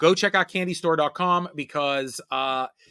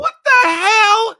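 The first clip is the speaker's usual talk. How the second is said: shocked shout; 11 dB louder, much slower than usual. Real, not acted. Shocked outburst at candy habit.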